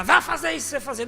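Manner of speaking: high, loud mock barked order; bossy husband shout. Husband ordering his wife around, mock shout. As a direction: play the domineering husband yelling at his wife. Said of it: "é o homem gritar".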